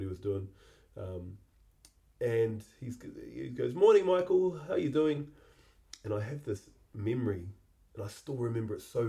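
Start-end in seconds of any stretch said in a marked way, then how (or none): 3.7-5.3 higher, louder chirpy morning greeting; a hammed-up cheerful neighbour calling good morning; neighbour's cheery hello. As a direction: call out brightly over the fence like the friendly neighbour.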